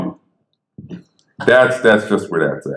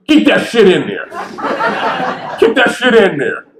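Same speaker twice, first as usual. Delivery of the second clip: Norman's line, higher and laughing; laughing Norman Lear; 12 semitones higher than usual. Retold or imitated. Imitating Norman Lear insisting he keep the recording, laughing. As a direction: quote him with a laugh, higher. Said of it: "He goes".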